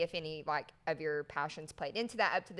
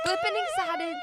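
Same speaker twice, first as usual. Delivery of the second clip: squeaky high; 4 dB louder than usual. Acted. Excited intro of the guest.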